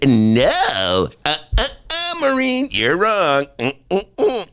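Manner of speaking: high, slow wagging tone; mock scolding, finger wagging; hammed-up know-it-all; smug correction. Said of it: "Only I said".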